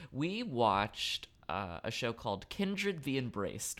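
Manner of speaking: ordinary talk, nothing marked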